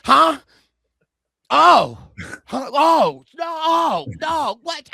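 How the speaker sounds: loud, flustered yelps of alarm; startled panic. Retold or imitated. Rick caught off guard, flustered loud yelps. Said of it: "Rick Devens said".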